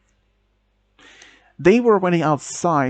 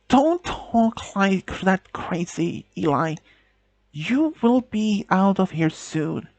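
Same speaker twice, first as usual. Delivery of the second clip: slow desperate plea; 5 semitones higher, slower than usual. Acted. Girlfriend pleads, denial.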